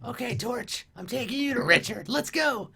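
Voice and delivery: high, hushed, eager goblin. Acted. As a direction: address the torch in a small high goblin voice. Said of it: "like, he's like".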